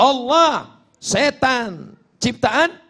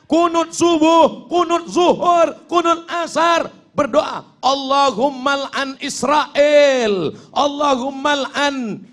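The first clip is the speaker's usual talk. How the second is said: fiery mock prayer; 7 semitones higher, slower than usual. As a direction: ham up the ranting prayer leader. Imitating an impassioned qunut curse.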